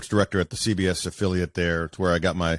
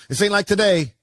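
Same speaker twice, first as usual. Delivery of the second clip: animated; 9 semitones higher, 4 dB louder, faster than usual. Real, not acted. Animated aside to host.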